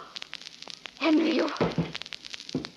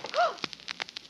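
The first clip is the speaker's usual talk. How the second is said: shocked gasp; 13 semitones higher, 6 dB quieter than usual. Acted. Wife finds the knife.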